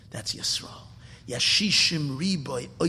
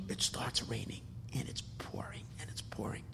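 hushed storytelling whisper; breathless narrator's whisper; dramatic whisper; 4 semitones lower, 15 dB quieter than usual. A narrator's reading. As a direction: drop to a whisper to build suspense.

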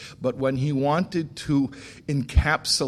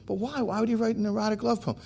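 much higher, fast imitation of a puzzled woman; baffled insistence; 9 semitones higher, 4 dB quieter, faster than usual. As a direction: cut in, still not getting it. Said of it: "we're going back and back and back and forth".

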